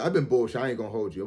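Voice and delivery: usual speaking voice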